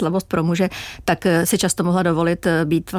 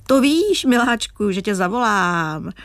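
Oldřich Nový; 2 semitones higher, much slower than usual. Retold or imitated. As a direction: imitate his inimitable drawn-out sweet talk. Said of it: "prohlásil svým nenapodobitelným způsobem".

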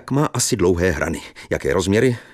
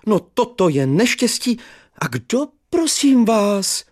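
put-on high, slow wail of an old man; lamenting teacher; 3 dB louder than usual. Old teacher laments the death news, high and slow.

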